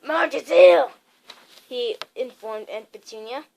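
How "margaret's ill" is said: loud gruff uncle reading news; Uncle Vernon voiced. A narrator's reading. Uncle Vernon tells Aunt Petunia, loud and blunt. Vernon reads postcard aloud.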